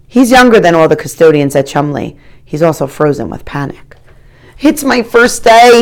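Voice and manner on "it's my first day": high, louder, slow shout; panicked janitor shouting over the flood. A narrator's reading. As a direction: shout over cascading water. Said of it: "he has to shout to be heard".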